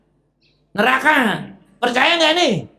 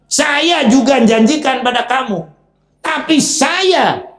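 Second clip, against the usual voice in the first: louder, higher, stretched-out taunt; Iblis taunting; 6 semitones higher, 5 dB louder, slower than usual. Acted. Iblis mocking his followers about his broken promise. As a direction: drag out the confession mockingly. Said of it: "pidatoni nyalah-nyalahkan".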